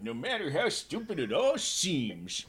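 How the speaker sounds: slow, high and loud cranky griping; curmudgeon. Acted. Correcting how Jack's line is said, hammed-up curmudgeon. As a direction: grumble it out like a put-on curmudgeon. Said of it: "He's a curmudgeon".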